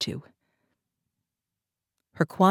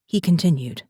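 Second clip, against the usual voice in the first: ordinary delivery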